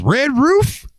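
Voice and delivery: very high, puzzled squeak of "Red roof?"; goofy customer voice. Retold or imitated. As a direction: ask it like a confused cartoon character. Odd customer's joke question.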